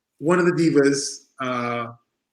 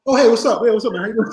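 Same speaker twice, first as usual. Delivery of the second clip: friendly retold greeting; 6 semitones higher, 3 dB louder, faster than usual. Acting out Bret's now friendly greeting.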